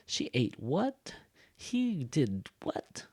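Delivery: slow, with "what" soaring up; incredulous echo questions rising on "what"; surprised tag question. Shown as a demonstration. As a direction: stretch each "what" into disbelief. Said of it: "like, showing surprise".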